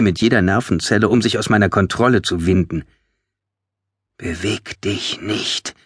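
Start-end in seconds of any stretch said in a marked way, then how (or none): 4.2-5.7 slow, hushed, spaced-out words; mouthed command. A narrator's reading. Narrator commands the Hollow, slow and hushed. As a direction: form each word softly and slowly. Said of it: "formte ich in Menschensprache mit den Lippen die Wörter".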